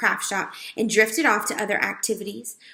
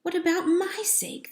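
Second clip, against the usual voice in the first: high, indignant question; 9 semitones higher than usual. A narrator's reading. Mouse thinking to himself, indignant and high. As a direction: protest to himself, put out. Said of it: "thought Ralph indignantly".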